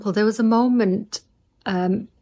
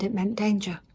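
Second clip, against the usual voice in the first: ordinary talk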